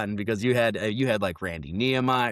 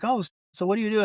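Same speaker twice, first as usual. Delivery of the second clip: quick, higher mock-friendly question; eager small talk; 10 semitones higher, much faster than usual. Politicians crowd the newcomer at the coffee table, quick and chirpy. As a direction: play the chatty schmoozer. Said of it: "they're like".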